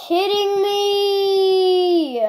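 long, drawn-out high wail; exasperated wail. Real, not acted. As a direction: wail it in disbelief, stretching the end. Disbelief at losing the game.

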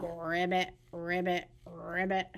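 low, soft ribbit croaks; frog croak. Acted. Croaking like a frog for the babies at story time.

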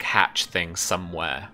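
ordinary talk

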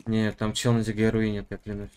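ordinary talk, nothing marked

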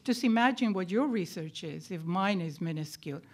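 ordinary talk, nothing marked

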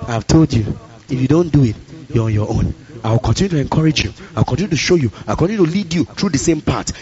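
low, measured voice of a patient God; calm, unslamming correction. Acted. God correcting a believer who won't listen, calm low-pitched patience. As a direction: warn gently, without slamming it. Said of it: "God is not like that".

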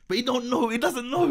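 high-pitched, laughing voice; amused disbelief. Real, not acted. Telling co-host the manager doesn't know him, laughing. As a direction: laugh through it, pitched right up.

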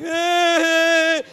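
high mock crying wail; imitated high sob. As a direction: wail like a crying girl, put on. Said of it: "she's crying like".

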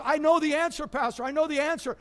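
put-on a teenager bursting to answer; excited kid. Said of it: "one of them got all excited".

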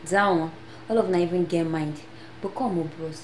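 usual speaking voice

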